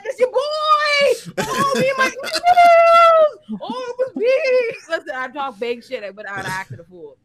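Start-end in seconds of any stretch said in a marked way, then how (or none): 0.0-5.0 high, slow, laughing shout; shrieking celebration through laughter; hyped shout. Pretend. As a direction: scream it like a wild victory party. Imagined hype celebration.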